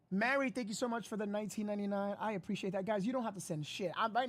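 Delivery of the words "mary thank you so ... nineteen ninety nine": normal talking voice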